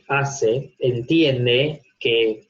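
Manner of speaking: ordinary talk, nothing marked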